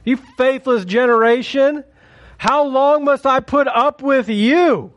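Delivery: high, slow exasperated rebuke; scolding. Retold or imitated. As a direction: scold them like a let-down teacher. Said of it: "Jesus scolds them".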